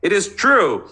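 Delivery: loud, high angry yell; shouting. Acted. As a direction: yell it at her with full force. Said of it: "he shouted".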